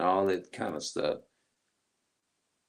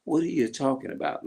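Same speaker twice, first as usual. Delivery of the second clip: mock defensive denial; 8 semitones higher than usual. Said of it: "people will say".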